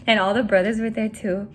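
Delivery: ordinary talk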